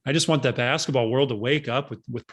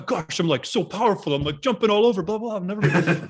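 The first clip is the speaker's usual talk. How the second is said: high, mock thick European accent; 7 semitones higher than usual. Player bragging to his trainer in mock thick European accent. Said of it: "in his thick accent".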